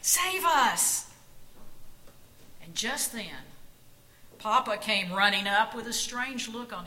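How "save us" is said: high, loud cheer; excited cry. A narrator's reading. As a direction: shout with the crowd. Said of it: "Miriam cried".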